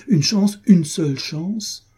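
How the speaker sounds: high, drawn-out pleading question; imploring, voice lifted high; desperate appeal. A narrator's reading. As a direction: plead for just one, slowing on the repeat. Desperate appeal in lovers' quarrel.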